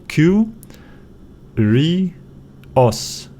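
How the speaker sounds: "Q, ri, os" as three separate syllables. Shown as a demonstration. Teacher breaking down a Greek word, syllable by syllable. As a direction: pause after each part of "Q, ri, os". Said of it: "break it down after a vowel".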